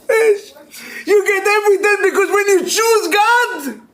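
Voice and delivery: high, exclamatory climax; rousing preaching. Real, not acted. Rabbi to class on choosing God, rousing proclamation. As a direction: lift the pitch high and proclaim it.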